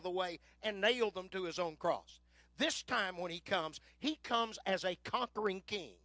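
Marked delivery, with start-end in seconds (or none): none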